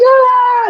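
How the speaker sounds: high mock scream; high-pitched crowd squeal, held back; imitated fan scream. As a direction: imitate screaming fans without much volume. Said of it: "And they're screaming".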